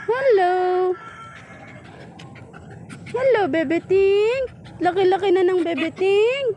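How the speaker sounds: high cooing hello. Real, not acted. Greeting the pet.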